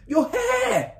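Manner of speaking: very high, loud horrified shriek; mother's shriek. A narrator's reading. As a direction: shriek it in shock. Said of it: "The mother was shrieking".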